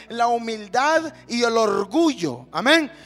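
loud, slow sermon delivery; emphatic preaching. Real, not acted. Preacher announcing humility against pride, emphatic preaching. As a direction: drive the key words home to the church.

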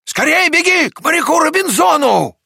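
high, loud urgent command; Авва shouting. A narrator's reading. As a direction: send someone running with an excited cry. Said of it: "закричала доктору Авва". (срочный высокий крик)

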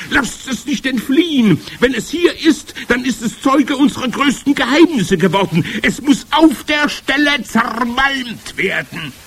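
loud, high head-witch tirade; screeching. A narrator's reading. Head witch orders the witness crushed, screeching. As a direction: shriek on, stretching the final threat. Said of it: "kreischte die Hoch- und Großmeisterhexe".

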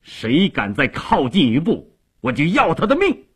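defiant protector's challenge; loud threatening cry. A narrator's reading. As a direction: pitch climbing into a fierce threat. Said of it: "大声地喝道".

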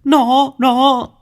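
high, stammering frightened cry; a boy too scared to finish the words; terrified whimper. A narrator's reading. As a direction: panicky stuttering child voice. Boy feels ghost hand.

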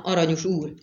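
ordinary delivery

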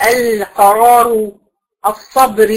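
القرار drawn out very slowly, ending on a still راء; slow recitation. Shown as a demonstration. Teacher slowly modelling the stop on القرار for the student. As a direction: stretch القرار out long and stop cleanly. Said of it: "لما بتيجي تقفي في القرآن عليها".

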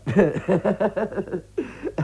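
a forced, uneasy chuckle; nervous fake laugh. Debtor laughs nervously at his creditor Joe, forced chuckle. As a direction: laugh it off to buy time.

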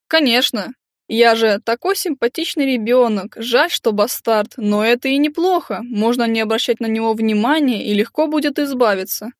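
lightly mincing, mimicking a lady; a hint of a simpering gossip; affected simpering voice. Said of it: "Жеманным голоском, явно копируя кого-то".

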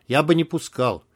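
usual speaking voice

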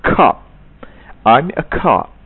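"cop" with a loud burst of air; right "cop"; aspirated k. Shown as a demonstration. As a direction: explode the k in "cop". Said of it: "bukan cop, cop".

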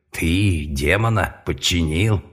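slow, astonished question; can't believe it; incredulous. Acted. Companion stunned by claim.

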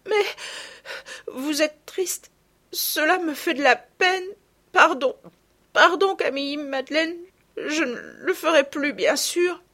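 high, slow, choked with tears; a little girl gasping through sobs; sobbing child. Acted. Marguerite, choking on tears, tells her sisters they are too kind, sobbing high. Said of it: "qui suffoquait".

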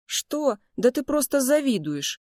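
high, indignant comeback; dismissive retort. A narrator's reading. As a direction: brush the accusation off. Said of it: "в свою очередь отмахнулся Луи". (пренебрежительно, свысока отмахиваясь)

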